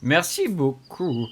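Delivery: usual speaking voice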